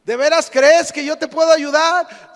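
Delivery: high, loud booming question; God's challenge, projected loudly. Retold or imitated. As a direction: call down to the climber from on high. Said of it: "se oyó una voz y le contestó".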